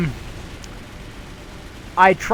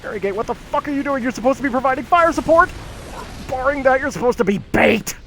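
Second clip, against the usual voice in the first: fast, higher frustrated scolding; exasperated yelling; 8 semitones higher than usual. Real, not acted. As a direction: chew out a useless teammate. Scolding AI teammate mid-fight.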